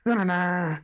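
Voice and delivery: high, sung-out dun-dun-dun sting; dramatic hamster sting. Retold or imitated. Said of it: "that, like, dramatic hamster".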